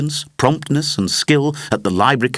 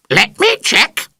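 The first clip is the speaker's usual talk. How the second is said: much higher, louder and slow, a parrot's squawk; parrot squawk at full volume; 13 semitones higher, 5 dB louder than usual. Acted. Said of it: "at the top of his voice".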